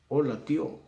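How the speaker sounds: "tío" in "hola tío" as one syllable, TYÓ; diphthong mispronunciation. Shown as a demonstration. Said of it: "no digo".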